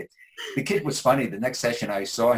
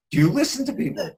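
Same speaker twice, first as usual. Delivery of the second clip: higher, louder imitated challenge; child's challenge; 6 dB louder, faster than usual. Nine-year-old boy challenges his therapist, imitated indignant question. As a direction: mimic a child taking an adult to task.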